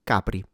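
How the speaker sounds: Capri with unaspirated C and P, stress first; Italian Capri. Shown as a demonstration. Teacher modelling final Italian Capri. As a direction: say Capri crisp, no puffs. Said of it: "consonants in Italian are not aspirated".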